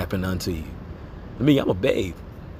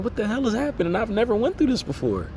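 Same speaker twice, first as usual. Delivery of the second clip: high, bewildered outburst; 13 semitones higher than usual. Retold or imitated. Recalling his tire blowing out daily, in a high bewildered voice. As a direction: lift the pitch in baffled disbelief. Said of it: "And I'm like".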